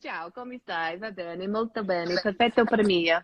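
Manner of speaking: put-on Italian accent; playful Italian voice. Shown as a demonstration. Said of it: "can I do an Italian accent?".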